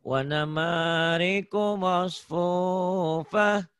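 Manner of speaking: slow tajwid chant; Quran recitation. Shown as a demonstration. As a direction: recite it slowly for the pupil.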